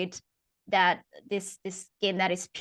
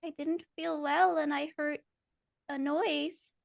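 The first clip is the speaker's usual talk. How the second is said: higher, quiet trembling plea; a frightened little girl begging; scared child; 8 semitones higher, 4 dB quieter than usual. Acted. Ashley pleading with her mother, scared. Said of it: "And she says".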